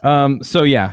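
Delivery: ordinary talk, nothing marked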